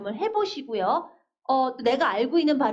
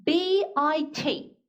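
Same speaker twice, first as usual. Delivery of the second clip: slow spelling out; 2 semitones higher, much slower than usual. Shown as a demonstration. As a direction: spell B I T letter by letter, slowly. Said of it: "같이 한번 또 연습해볼까요". (천천히 한 글자씩)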